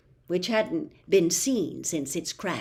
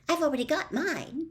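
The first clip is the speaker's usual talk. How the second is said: higher, chirpy and pleased; brightly; 9 semitones higher than usual. A narrator's reading. Hermione telling Harry she's already prepared, bright. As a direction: show off that you're ahead. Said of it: "said Hermione brightly".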